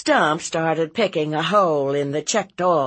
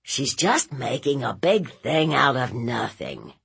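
dismissive nephew. Acted. Nephew playing it down to aunt, dismissive. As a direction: shrug it off in a subdued voice.

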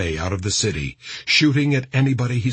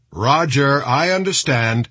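raised, higher radio acknowledgement; lightly voiced commander on the net; commander replying by radio; 5 semitones higher, 4 dB louder than usual. A narrator's reading.

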